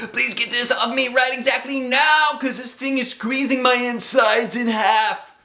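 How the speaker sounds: high, urgent squeal; frantic pleading. A narrator's reading. Girl rushes to Mother for help, frantic. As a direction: plead shrilly for rescue.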